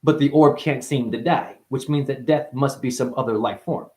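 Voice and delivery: normal talking voice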